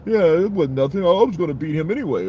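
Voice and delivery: high, loud, cocky boxer brag; mock a pompous fighter's boast; pompous boxer impression. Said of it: "His attitude was kind of fucking pompous".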